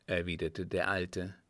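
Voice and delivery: ordinary delivery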